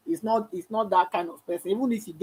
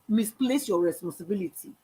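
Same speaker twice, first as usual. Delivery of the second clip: ordinary delivery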